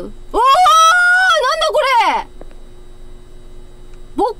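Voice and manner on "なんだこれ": drawn-out startled shout; shocked cry. Real, not acted. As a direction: yell it out in shock. Startled gamer reacting to anomaly.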